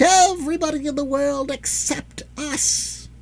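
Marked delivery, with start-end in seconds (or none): 1.5-3.1 slow creature whisper; slow gloating whisper; whispered aside. Acted. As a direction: hiss it as a secret. Dwarves end with secret.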